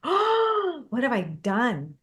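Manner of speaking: high gasping "Oh" then slow dismay; mock horror. Realizing she said y'all on a call, mock horrified. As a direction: caught out, mock-horrified at herself. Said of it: "and I'm like".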